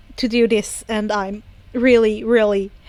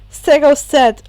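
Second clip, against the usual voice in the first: plain reading voice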